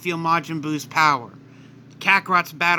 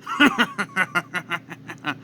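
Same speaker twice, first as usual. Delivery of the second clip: evil laugh. Acted. Villain Kakarot reveals his anger.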